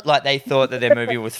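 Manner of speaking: normal talking voice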